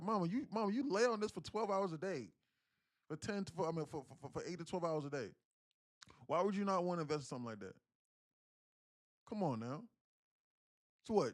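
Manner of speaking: retold lecture. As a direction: push the point hard at mama. Lecturing mama, raised voice.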